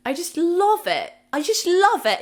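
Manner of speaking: high, loud gushing love. Real, not acted. Vlogger gushing about loving reality TV, high and loud. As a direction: gush over your guilty pleasure. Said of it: "I can't explain".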